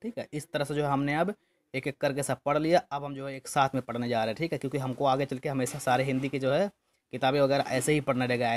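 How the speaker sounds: ordinary delivery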